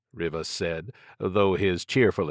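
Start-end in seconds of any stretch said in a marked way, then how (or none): none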